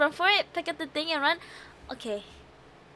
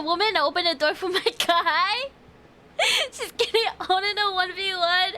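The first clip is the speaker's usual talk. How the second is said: usual speaking voice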